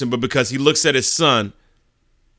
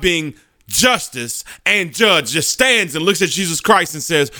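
ordinary talk, nothing marked

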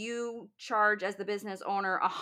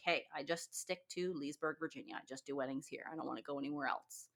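soft mock artist; 5 semitones lower, 11 dB quieter, faster than usual. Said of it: "be like".

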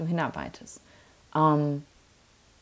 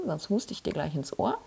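ordinary talk, nothing marked

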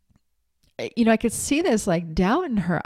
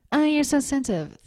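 mocking; 8 semitones higher than usual. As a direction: parrot the put-down with a sneer. Mocking someone's dismissive remark.

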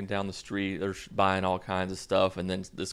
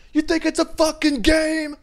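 full-out high, loud rap yell; mock rapper scream; 8 dB louder than usual. What he wanted to yell, full-out loud rapper scream. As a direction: let rip like a screaming rapper. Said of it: "I wanted to be like".

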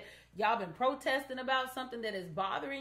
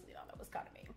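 whispered aside; 16 dB quieter, much faster than usual. Real, not acted. Aside about earlier quotes.

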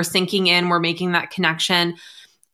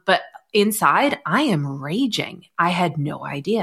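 ordinary delivery

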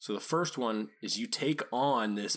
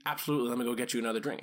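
brisk fix-it reply; much faster than usual. Retold or imitated. Proactive worker answering boss.

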